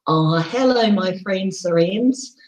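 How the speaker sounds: ordinary talk, nothing marked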